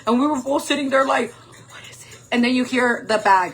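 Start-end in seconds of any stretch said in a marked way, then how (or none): 1.3-2.2 breath-only whisper, afraid of being heard; hushed whisper. Retold or imitated. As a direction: whisper it, barely audible. Both frightened in the tent.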